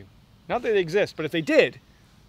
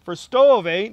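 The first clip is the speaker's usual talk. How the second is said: a light Canadian accent on the eh; 3 semitones higher, 7 dB louder, slower than usual. Shown as a demonstration. Guy mocking his own Canadian eh. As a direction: play up the Canadian eh, loud and high. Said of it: "I must be Canadian".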